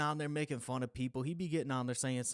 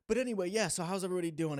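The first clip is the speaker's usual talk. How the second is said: high, bright-ish call to the audience, a bit louder; upbeat greeting; 7 semitones higher, 5 dB louder, faster than usual. Real, not acted. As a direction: greet the viewers with a lift. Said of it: "for the fourth time I'm saying this".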